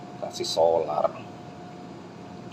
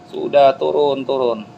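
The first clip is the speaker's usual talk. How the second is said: high heckling call; cemooh pedas penonton; 6 semitones higher, 5 dB louder, slower than usual. Acted. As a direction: jeer the climber down in a raised pitch. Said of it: "itu omongannya pedas-pedas". (cemoohan penonton bernada tinggi)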